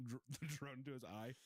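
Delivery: ordinary talk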